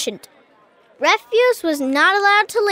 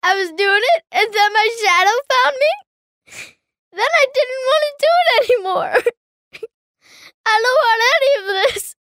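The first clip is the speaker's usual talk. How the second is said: high, tearful words broken by sobs; crying; 9 semitones higher than usual. Acted. Boy explaining his shadow to Frank and Mirella, crying hard. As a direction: blurt it out between sobs. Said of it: "some of his words were lost in gurgles and spurts".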